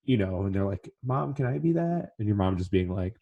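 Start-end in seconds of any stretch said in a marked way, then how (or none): 1.0-2.1 put-on higher, slow, hopeful little kid; young kid asking. Child asking his mom if he can be a hero, higher and slow. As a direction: plead gently like a small child.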